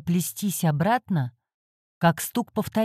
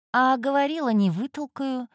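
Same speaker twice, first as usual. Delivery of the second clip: smug mutter; 7 semitones higher than usual. A narrator's reading. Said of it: "пробормотал кошак".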